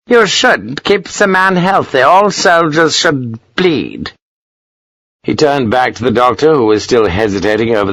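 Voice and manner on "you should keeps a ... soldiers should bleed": high-pitched, emphatic officer's pronouncement; pompous colonel. A narrator's reading. Colonel lecturing a captain on bleeding, pompous. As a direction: lay it down high, drawing out the last words.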